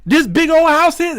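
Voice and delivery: can't believe it's a garage; shocked. Retold or imitated.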